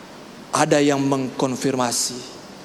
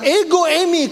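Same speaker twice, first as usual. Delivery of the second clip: proclaimed words; 14 semitones higher, 3 dB louder than usual. Acted.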